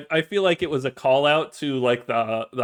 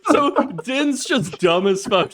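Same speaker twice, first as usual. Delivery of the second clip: ordinary talk, nothing marked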